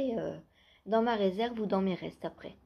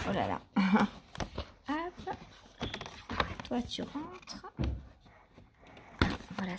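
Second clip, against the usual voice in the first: ordinary delivery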